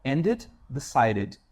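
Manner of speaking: "Ended, decided" drawn out slowly; slow id ending. Shown as a demonstration. Teacher slows down to show the id ending. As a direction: stretch each id ending slowly. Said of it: "E, id".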